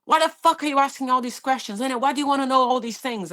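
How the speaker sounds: man shouting at paramedic to stop asking questions, angry; aggressive man quoted. Retold or imitated. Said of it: "he was just like".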